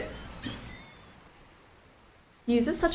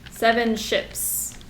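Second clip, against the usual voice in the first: dramatic read; much slower than usual. Acted.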